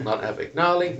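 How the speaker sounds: "Abagnale" as "Abag-NAH-lee"; wrong pronunciation. Retold or imitated. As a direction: reject "Abagnale" said with a "-nahlee" ending. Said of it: "No, he's like".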